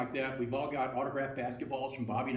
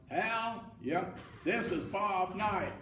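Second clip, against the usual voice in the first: higher, drawn-out coach on the phone; Bob Knight; 6 semitones higher, much slower than usual. Retold or imitated. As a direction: announce yourself slowly in an imitated voice. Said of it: "pretty good Bobby Knight imitation".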